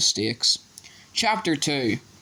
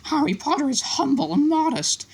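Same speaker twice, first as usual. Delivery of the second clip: much higher, reverent elf voice. A narrator's reading. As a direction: speak in awe like a small elf. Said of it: "said Dobby reverently".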